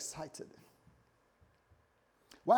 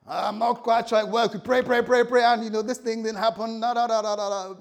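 loud, high exasperated whine; hammed-up griping believer yelling; frustrated complaining; 8 semitones higher, 14 dB louder than usual. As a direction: rant like someone fed up with prayer.

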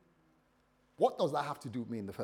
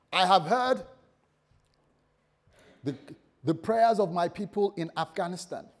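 loud, raised proclamation as God; booming voice of God; 5 semitones higher, 6 dB louder than usual. Acted. God calling Sister Shay from the burning bush, loud and booming.

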